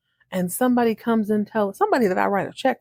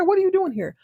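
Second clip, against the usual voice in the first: much higher, imperious question; haughty disdain; 12 semitones higher, faster than usual. Retold or imitated. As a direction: demand it like you own the room. Imitating unbothered Alexis.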